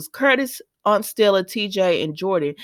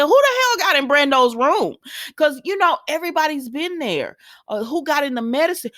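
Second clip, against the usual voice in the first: outraged disbelief; 9 semitones higher than usual. Real, not acted. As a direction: demand to know who did it. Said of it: "outraged questions about the attack".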